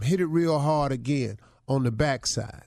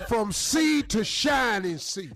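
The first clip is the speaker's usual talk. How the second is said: slow, high shouted call; hype man shout; 6 semitones higher, slower than usual. Acted. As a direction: stretch it out like an arena call.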